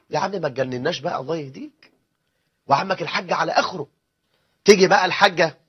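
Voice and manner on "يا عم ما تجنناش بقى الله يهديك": soft, fed-up low grumble; exasperated uncle. Retold or imitated. Weary uncle groaning at the fiancé who won't leave, soft and fed up. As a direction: grumble under your breath, worn out.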